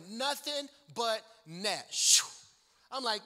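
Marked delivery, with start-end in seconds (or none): 1.9-2.2 loud imitated swish of a basketball net; swish sound. Narrator after nothing but net, imitated swish. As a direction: hush out a sharp swish.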